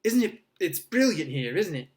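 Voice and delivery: thrilled, words tumbling out high and loud; excited. A narrator's reading. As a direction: gush with boyish excitement. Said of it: "great shuddering breath of excitement".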